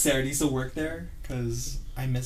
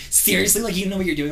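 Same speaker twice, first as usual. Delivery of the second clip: higher, louder mocking seriously; snide coworker; 7 semitones higher, 5 dB louder, faster than usual. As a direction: sneer it at the clueless newbie. Mean coworker's retort retold.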